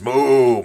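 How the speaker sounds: loud, long mooing; cow moo. Pretend. Player as cannibal cow, loud mooing. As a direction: moo loudly, drawing it out.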